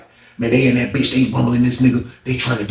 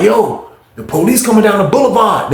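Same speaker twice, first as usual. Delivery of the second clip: high, urgent retold alert; called-out warning; 7 semitones higher than usual. Inmates warning that police are coming, urgent call. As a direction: call it out to the block, voice raised in pitch.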